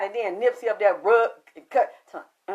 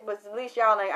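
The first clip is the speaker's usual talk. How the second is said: normal talking voice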